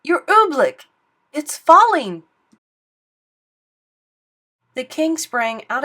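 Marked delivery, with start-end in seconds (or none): 0.0-2.2 loud, high alarmed shout. Acted. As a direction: cry out the warning. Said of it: "he shouted".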